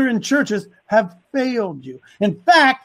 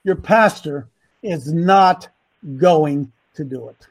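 slow, lowered voice, pausing after "not"; real slow; 8 semitones lower than usual. Shown as a demonstration. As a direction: spell out "not going to do it" slowly and deliberately. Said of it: "Let me say it again, real slow".

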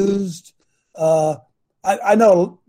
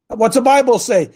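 ordinary talk

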